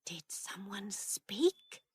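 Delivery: slow, hesitant whispered question. A narrator's reading. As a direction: whisper it warily into the silence. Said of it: "heard her Aunt Pauline whisper the words".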